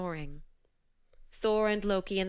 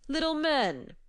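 giant shouting; 8 semitones higher than usual. A narrator's reading. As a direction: call down to tiny men. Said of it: "he shouted to them".

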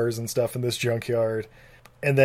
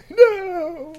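anguished cry. Acted. Acted husband's final cry.

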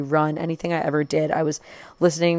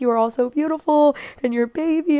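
high-pitched fan gushing, retold; gushing compliment; 10 semitones higher than usual. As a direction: coo over someone's family. Acts out complimenting the family.

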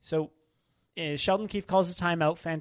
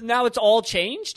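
slow, loud, higher mock outrage; mock disbelief; 6 semitones higher, 9 dB louder, slower than usual. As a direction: drag out the disbelief. Mock incredulous question.

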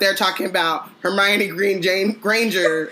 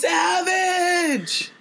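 high drawn-out cheer; savage cheer; 9 semitones higher than usual. Real, not acted. Co-host cheering Hermione's burn, high drawn-out cheer. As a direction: cheer it like a fan at a show.

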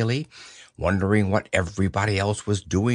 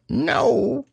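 much higher squeaky put-on voice; a higher disguised Rabbit voice. A narrator's reading. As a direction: Rabbit pretending not to be himself. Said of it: "in a different sort of voice".

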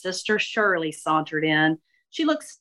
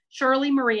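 plain reading voice

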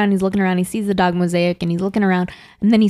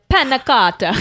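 playful hint of an Italian accent, pitched up; mock Italian; 5 semitones higher than usual. Co-host teasing a friend about Italians on the subway, mock Italian. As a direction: lightly put on an Italian accent.

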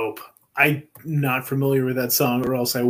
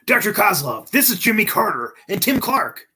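acted introduction; 6 semitones higher than usual. Reading a comic's character lines.

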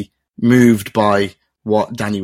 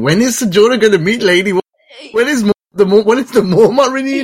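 excited, laughing; 11 semitones higher, 4 dB louder than usual. Real, not acted. Fan who waited two episodes for the reunion, excited and laughing. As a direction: pitch shooting much higher, laughing through the words. Eagerly awaited the reunion scene.

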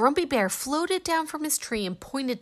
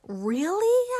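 hopeful surprise; 9 semitones higher, 5 dB louder than usual. Acted. Hopeful question to bear.

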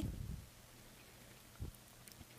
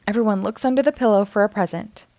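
ordinary delivery

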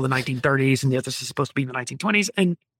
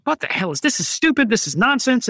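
mock exasperation, pitched up; retold indignation; 7 semitones higher than usual. Moviegoer recalling his first reaction to Prometheus, indignant. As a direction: replay an incredulous first reaction.

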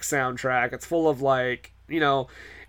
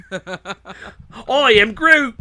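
slow, drawn-out laughing Groot line; Groot impression; 5 semitones higher, 4 dB louder, much slower than usual. Host laughing through a slow Groot impression. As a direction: stretch it out like Groot, giggling. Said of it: "I thought you said Groot".

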